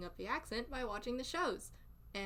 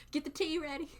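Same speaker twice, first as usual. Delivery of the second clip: joking through laughter; 5 semitones higher, 5 dB louder than usual. Acted. Joking in accent amid laughter.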